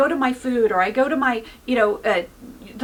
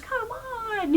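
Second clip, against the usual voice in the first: high, soft voice breaking into tears; real emotion catching in the throat; choking up; 11 semitones higher, 7 dB quieter than usual. Real, not acted. Preacher moved by own story.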